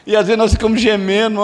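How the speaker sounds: normal talking voice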